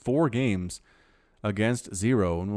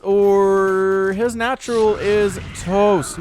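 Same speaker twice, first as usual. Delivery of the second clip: excited; 8 semitones higher, 8 dB louder, much slower than usual. Real, not acted. Big moment in the match.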